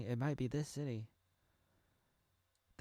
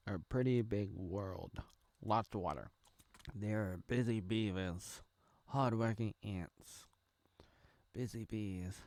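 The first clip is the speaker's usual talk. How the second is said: ordinary delivery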